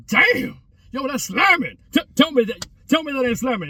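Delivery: a high, loud "Damn!" of delight; amazed. Retold or imitated. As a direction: blown away by the taste. Tasting father's food, amazed.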